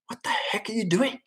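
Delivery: high, hushed, incredulous dad voice; baffled dad. Acted. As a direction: say it softly and high, utterly baffled. Imagined dad baffled at pleading son.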